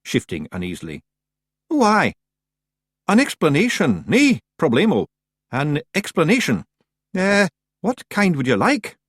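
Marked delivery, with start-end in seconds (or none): 1.7-9.0 high, loud, nervous Scots pixie voice; uneasy. Acted. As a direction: stall for time nervously. Rob stalling nervously.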